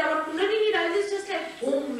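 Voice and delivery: high, eager exclaiming; bursting with delight; excited. Retold or imitated. Devotees enthusing.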